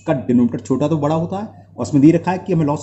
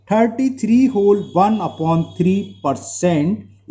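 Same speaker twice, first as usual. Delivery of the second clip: slow dictation; 3 semitones higher, much slower than usual. Real, not acted. Teacher states answer slowly.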